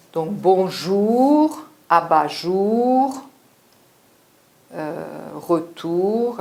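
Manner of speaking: "bonjour", "abat-jour", "retour" said slowly, stretching the "ou"; slow each word to show the "ou"; careful slow teacher demo of "ou"; drawn-out "ou" words. Shown as a demonstration. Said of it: "c'est le son du U brésilien".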